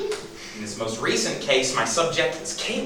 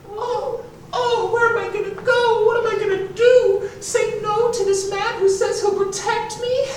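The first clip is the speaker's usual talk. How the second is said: very high-pitched damsel in distress; mock frightened woman. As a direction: put on a helpless, panicky falsetto. Voicing the frightened woman in danger.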